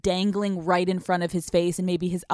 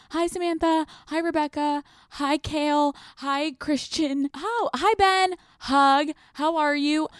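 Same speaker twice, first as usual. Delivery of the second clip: higher, breezy greetings down the line; casual friendly; 8 semitones higher than usual. Shown as a demonstration. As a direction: greet everyone the same easy way. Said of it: "You treat him like any other person".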